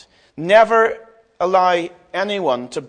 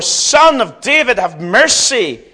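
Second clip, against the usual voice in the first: loud, slow, high plea; shouting all the more; 5 semitones higher, 6 dB louder, slower than usual. Acted. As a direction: shout each word long and hard. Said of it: "he shouted all the more".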